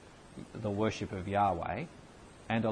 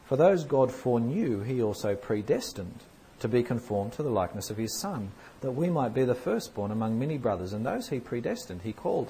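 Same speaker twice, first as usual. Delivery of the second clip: loud, measured Bible reading; scripture reading; 5 dB louder than usual. Acted. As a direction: proclaim the verses to the room. Reads Romans 8 aloud.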